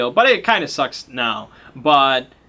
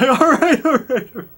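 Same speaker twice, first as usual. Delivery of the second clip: squeaky, much higher pleading voice; panicked high NPC; 10 semitones higher than usual. Acted. Cornered man caving in to Richard's gun, high panicked squeak. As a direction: cave in fast with a high squeak.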